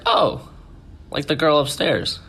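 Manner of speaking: high-pitched innocent child imitation; little boy. Boy tells his mom about the girl, imitated child. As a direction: answer lightly like a six-year-old.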